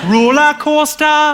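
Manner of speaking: high, slow Geordie "Roller coaster"; Geordie accent. Shown as a demonstration. Comic demonstrating Geordie key words, high slow accent. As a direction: sing out "Roller coaster" in stretched Geordie vowels. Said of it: "trying to do the Geordie accent".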